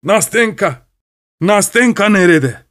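high, furious call for his daughter; angry shout. A narrator's reading. Old father storms in demanding his daughter, shouting angrily. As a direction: bellow the name Nastenka in anger. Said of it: "hiddetli bir sesle bağırdı".

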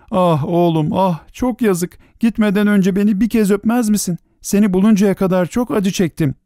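high-pitched, grieving plea; tearful lament. A narrator's reading. Mother asks her son for one kiss, tearful. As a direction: lament as if close to tears. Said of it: "diye ağladı".

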